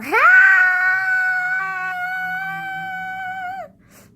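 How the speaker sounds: very high, loud sustained note; imagined singing voice. Shown as a demonstration. Showing off an imagined singing voice, exaggerated high held note. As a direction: belt out one long piercing note. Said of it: "So I imagine my singing voice being".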